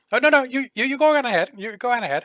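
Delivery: a nervous merchant waving off the question; flustered shopkeeper. Acted. NPC cuts in on the talk.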